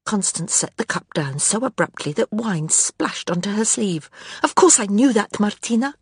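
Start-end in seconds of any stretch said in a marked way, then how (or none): 4.4-5.9 angry. A narrator's reading. Constance bursts out in anger.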